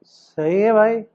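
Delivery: "सही है भाई" slow, heart sinking; hurt, deflated. Shown as a demonstration. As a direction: say "सही है भाई" slowly, betrayed. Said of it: "मेरे पीछे बोलो।".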